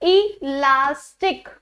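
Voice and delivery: इलास्टिक said very slowly, syllable by syllable; pronunciation demo. Shown as a demonstration. Teacher slowly modelling इलास्टिक for her students. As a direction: draw out इलास्टिक slowly for the class. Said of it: "मैं क्या बोल रही हूँ".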